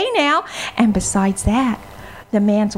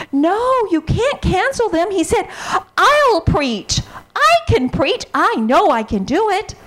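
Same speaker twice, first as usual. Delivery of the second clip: put-on eager pleading boy; 8 semitones higher than usual.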